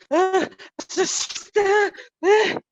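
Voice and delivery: loud, high exaggerated sighs; big mock-stressed gasps; overacted stressed huffing. Shown as a demonstration. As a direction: huff and puff in hammed-up stress. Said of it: "and you are stressed".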